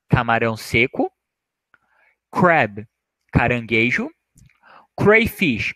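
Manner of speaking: ordinary talk, nothing marked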